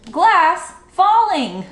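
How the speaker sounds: high, loud sailor's report shouted to shore; calling out. A narrator's reading. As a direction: sing out the warning across the water. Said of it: "he waved his hat and called out".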